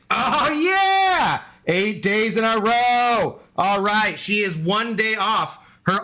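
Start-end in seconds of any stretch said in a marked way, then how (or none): 0.1-1.4 real delighted shout of triumph; excited cheer. Real, not acted. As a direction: cheer the dog's catch. Dog catches treat, cheering.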